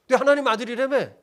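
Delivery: loud scornful final jeer; mocking jab. Chief priests jeering at Jesus, loud sneering jab. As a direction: throw the last taunt in his face. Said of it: "또 실감나게 제가 얘기하면 이런 거예요".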